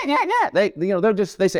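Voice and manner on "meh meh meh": high, whiny imitation of a crying baby; preacher mimicking a crying infant, high and whiny; mock baby whimper. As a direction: whimper like a fussy toddler, pitched high up. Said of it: "when they're going".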